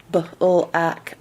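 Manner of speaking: b-l-ak, b and l kept apart; choppy unblended again. Shown as a demonstration. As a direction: repeat the broken b-l-ak. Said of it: "trouble putting the B and the L together".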